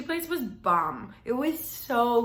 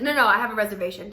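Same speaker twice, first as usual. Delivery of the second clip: loud and fast, pushing past her; aggressive insistence; 8 dB louder, faster than usual. Retold or imitated. Date insisting to hostess, loud and fast. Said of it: "like, aggressive in a way".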